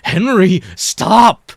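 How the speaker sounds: high, loud pleading cry; desperate cry. A narrator's reading. Mike cries out to Henry during the fight, high and loud. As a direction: cry out for him to stop. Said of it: "Mike cries".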